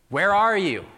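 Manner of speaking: loud, high-pitched cry to God. Acted. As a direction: burst out, demanding an answer. Same question escalates to a cry.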